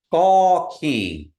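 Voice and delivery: Gaw keen loud, falling, nasal keen; model pronunciation. Shown as a demonstration. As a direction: say Gaw keen for them to copy. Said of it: "everybody repeat after me".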